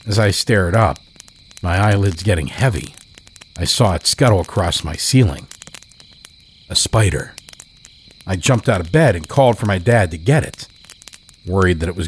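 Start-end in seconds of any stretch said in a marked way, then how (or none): none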